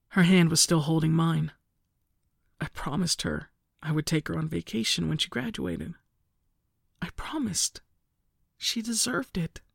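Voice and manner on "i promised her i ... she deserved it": softer faint voice, fading to a whisper; barely able to get it out. A narrator's reading. Narrator to mother about sister's promise, faint and broken. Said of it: "My voice was faint".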